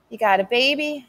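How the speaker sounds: plain reading voice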